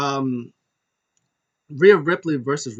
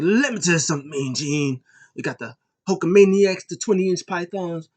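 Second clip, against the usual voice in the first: louder, higher hyped-up wrestler promo; Hulk Hogan; 5 semitones higher, 5 dB louder than usual. Retold or imitated. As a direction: ham up a big Hulk Hogan shout. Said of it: "Drake Maverick was dressed as Hulk Hogan".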